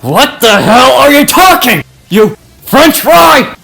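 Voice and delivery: loud, high furious yell; angry shout. Acted. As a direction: scream the insult, slowing at the end. Bakugo shouts insult.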